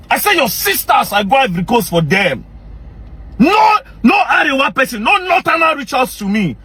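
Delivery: high, cracking, shouted grievance; anguished shout. Real, not acted. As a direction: cry it out in outrage. Aggrieved outburst about rescued girls.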